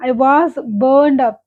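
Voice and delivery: each word spelled out slowly for learners; teacher reading the example very slowly. Shown as a demonstration. English teacher reading a sample sentence to students, very slowly. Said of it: "teacher repeats the example sentence".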